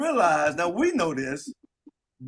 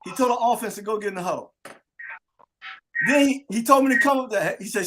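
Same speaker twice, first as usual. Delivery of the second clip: ordinary delivery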